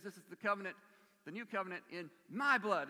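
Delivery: loud, firm covenant words; emphatic ringing declaration. Acted. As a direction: drive home the new covenant. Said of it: "and it's emphatic".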